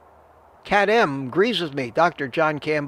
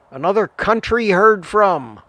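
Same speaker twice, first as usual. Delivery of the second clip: playful exclamation; 5 semitones higher, 6 dB louder than usual. Real, not acted. Cheerful loud greeting of newcomer.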